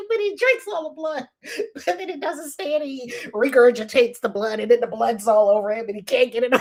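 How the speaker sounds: ordinary talk, nothing marked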